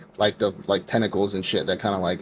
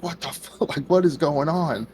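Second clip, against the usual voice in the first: shocked; 6 semitones higher than usual. Retold or imitated. Knight sees slaughter.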